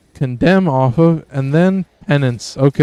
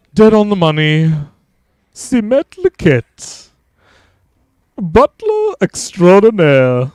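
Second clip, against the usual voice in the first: hammed-up butler with a higher, swooping pitch; Simet Liket voice; 4 semitones higher, 3 dB louder than usual. Streamer as the French bakery's expert butler, hammed-up put-on voice. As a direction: play a grand confectioner, stretching the words out. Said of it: "What's the voice to that name?".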